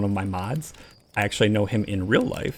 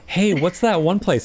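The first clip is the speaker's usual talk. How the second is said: eager curious question; 7 semitones higher than usual. Retold or imitated. Eager quote in story.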